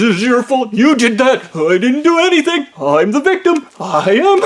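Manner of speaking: high-pitched mock victim; whiny blame-shifting. Manager denies all accountability, whiny self-pity.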